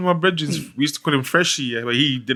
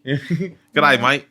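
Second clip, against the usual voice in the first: jokey stock Australian greeting; Australian accent; much slower than usual. Shown as a demonstration. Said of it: "Can you do an Australian accent?".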